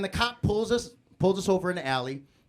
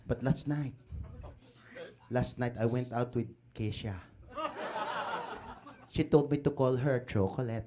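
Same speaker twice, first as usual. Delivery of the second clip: put-on Filipino uncle with a thick accent; Tito Chito. Uncle telling the crowd about his dates, boastful Filipino-accented character.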